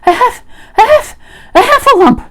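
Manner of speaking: high, loud, breathless stammer; winded and squealing with fright; Piglet. A narrator's reading. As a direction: force the word out in panicked bursts. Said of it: "breathing so hard that he could hardly speak".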